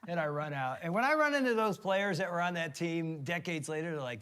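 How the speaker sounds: ordinary delivery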